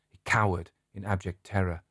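plain reading voice

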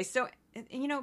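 usual speaking voice

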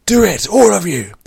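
high, loud, impatient shout; bellowed order. A narrator's reading. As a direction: roar the command at everyone. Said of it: "Vor bellowed in the compartment".

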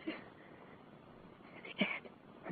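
frightened whisper. Acted. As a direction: breathe it out scared.

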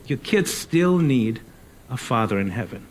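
ordinary talk, nothing marked

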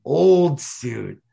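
put-on "Old" hit high and loud, "suit" falls away; stress on old. Shown as a demonstration. Picking apart the author's word choice, landing on "Old". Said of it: "he could have said blue suit".

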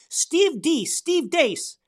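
mock the rival's name with disdain. Real, not acted. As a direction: spit the name out with scorn.

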